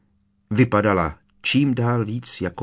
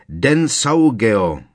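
loud, slow, drawn-out spell cry; cried-out spell; 7 dB louder, much slower than usual. A narrator's reading. Malfoy crying out his counter-curse, slow loud shout. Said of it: "vykřikl Malfoy".

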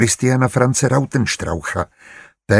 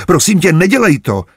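high, loud, panicked begging; alarmed, imploring cry; frightened plea; 8 semitones higher, 5 dB louder than usual. A narrator's reading. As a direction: plead in fright, voice jumping up. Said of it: "Úlek jí šel z duše".

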